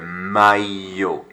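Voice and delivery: "maillot" said my-yo, no L; "maillot" with a Y glide in place of LL; LL as a Y sound. Shown as a demonstration. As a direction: isolate the tricky word for the class. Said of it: "Look at the double L sound".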